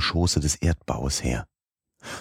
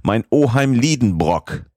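slow, high and loud call to the uncle; calling out clearly; 7 semitones higher, 4 dB louder than usual. A narrator's reading. Calling to his uncle through the wall, slow loud call. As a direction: call each word slowly through the rock wall. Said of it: "sprach so deutlich wie möglich die Worte".

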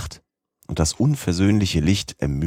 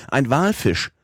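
high, excited call of the whale sighting; 7 semitones higher, slower than usual. A narrator's reading. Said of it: "rief darauf der Professor". (aufgeregter Ausruf, höher)

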